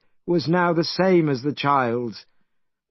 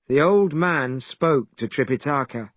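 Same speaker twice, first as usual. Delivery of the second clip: ordinary delivery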